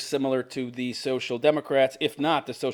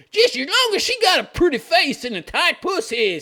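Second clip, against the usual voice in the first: mock king father; 14 semitones higher, 4 dB louder than usual. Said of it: "says their daddy".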